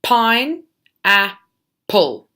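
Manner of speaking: Pine, a, pull, each syllable said apart and loud; teacher sounding out pine, a, pull syllable by syllable; split into syllables. Shown as a demonstration. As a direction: clap out pine, a, pull slowly, one beat each. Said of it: "Okay, so syllables".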